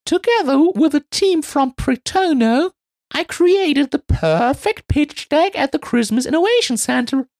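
high, loud eager elf; high proud Marie voice. Acted.

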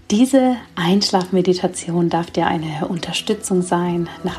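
sleep meditation. Acted. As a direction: guide them gently into sleep.

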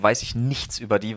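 normal talking voice

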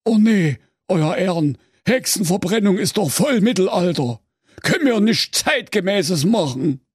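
high pleading Saxon executioner; sensitive executioner. Acted. Sensitive executioner objecting to the king, high pleading voice. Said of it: "Henker Henk Henkel und sprach".

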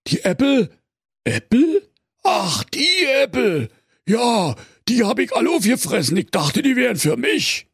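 very high, sheepish bear voice; embarrassed bear. A narrator's reading. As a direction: caught out, stalling bear. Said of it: "antwortete verlegen".

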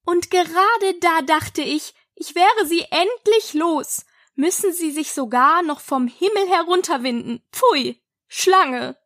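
mildly heated, ending in disgust. A narrator's reading. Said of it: "mit erhobener Stimme fort".

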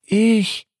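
cautious call. Acted. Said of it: "ruft es noch einmal vorsichtig".

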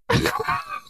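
high shrieking burst of laughter. Real, not acted. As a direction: squeal with laughter. Shocked laughter after punchline.

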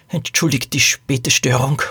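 lightly voiced old lady, murmuring; a touch of an apologetic mutter. A narrator's reading. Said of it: "murmelte die alte Dame". (leicht murmelnde alte Dame)

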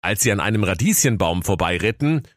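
ordinary delivery

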